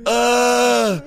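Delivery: loud, high groans of burning pain; nose burning. Real, not acted. Just snorted hot Cheeto dust.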